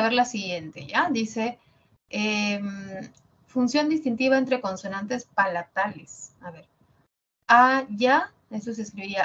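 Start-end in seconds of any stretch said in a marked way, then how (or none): none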